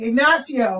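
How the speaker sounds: wholehearted greeting. Retold or imitated. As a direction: hail someone by full name, in earnest. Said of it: "meant it, like, so wholeheartedly".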